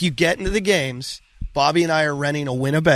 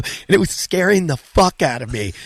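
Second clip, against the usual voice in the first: ordinary talk, nothing marked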